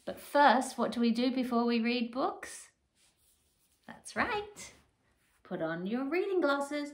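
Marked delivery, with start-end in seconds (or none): none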